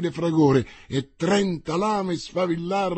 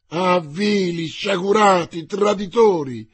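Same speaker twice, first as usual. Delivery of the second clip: loud, higher insults, slowing; furious shout; 4 semitones higher, 7 dB louder, slower than usual. A narrator's reading. Winter curses his fleeing men, shouting. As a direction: hurl the insults at the deserters. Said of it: "gridava Winter".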